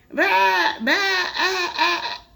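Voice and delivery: high, bleating goat cry. Acted. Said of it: "Grover let out a sharp".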